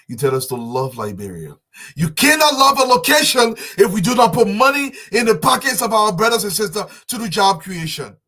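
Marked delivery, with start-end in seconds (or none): 2.0-8.2 high, loud outburst of protest; fiery loud plea, pitched high; impassioned shout. Real, not acted. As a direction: shout it up at the president. Impassioned appeal to the president.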